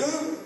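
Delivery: high, loud "ugh" of disgust; mock disgusted squeal. Kids find their shoes being swapped, mock disgust.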